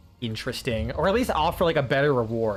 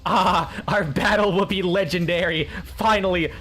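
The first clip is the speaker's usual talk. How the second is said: raised, grandly declaimed battle greeting; mock-heroic warrior; 4 semitones higher than usual. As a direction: hail a worthy foe in mock grandeur. Mock-heroic greeting to a game enemy.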